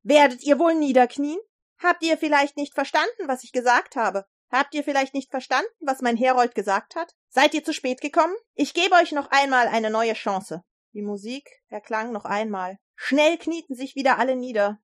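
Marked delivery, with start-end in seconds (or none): 0.0-10.6 raised, higher, indignant voice; shouting king. A narrator's reading. King confronts the three who stayed standing, shouting. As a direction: scold them loudly as an outraged ruler. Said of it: "schrie der König".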